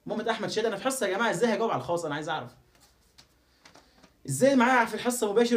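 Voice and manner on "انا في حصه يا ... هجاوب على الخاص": normal talking voice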